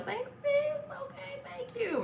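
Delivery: very high, light little thank-you; gushing thanks. Real, not acted. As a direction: squeak out your thanks to a friend. Thanks viewer for nail compliment.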